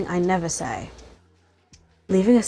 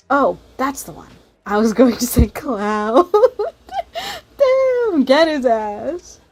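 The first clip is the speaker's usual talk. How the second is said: shrieking laugh, much higher and louder; 7 semitones higher, 6 dB louder, slower than usual. Real, not acted. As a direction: cackle and cheer the burn. Table erupts at the jab.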